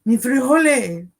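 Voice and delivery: high, slow mock-Spanish flourish; put-on comic voice. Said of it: "use that voice".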